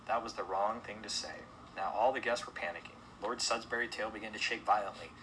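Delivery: ordinary delivery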